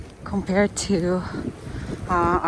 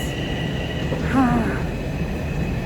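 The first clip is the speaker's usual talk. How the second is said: heavy exhale; 4 semitones higher, 4 dB quieter than usual. Real, not acted. After climbing subway stairs.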